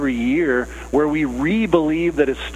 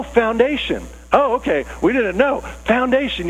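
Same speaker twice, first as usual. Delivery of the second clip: surprised realization; 7 semitones higher than usual. Acted. Disciple realizing who Simon is.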